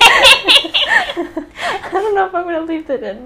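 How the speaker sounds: high, loud, slowed by laughing; helpless laughter. Real, not acted. Host doubting the edit, laughing hard. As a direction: talk right through a laughing fit.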